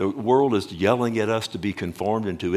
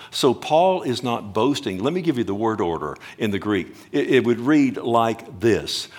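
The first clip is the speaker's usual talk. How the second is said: usual speaking voice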